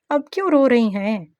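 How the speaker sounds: a touch of flustered concern. A narrator's reading. Man sees her crying, flustered. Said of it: "Suresh घबरा गया".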